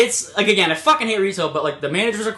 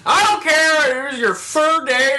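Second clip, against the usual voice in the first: loud, high mock outburst; hammed-up customer tirade; irate old customer; 7 semitones higher, 4 dB louder, slower than usual. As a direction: imitate a fed-up customer yelling.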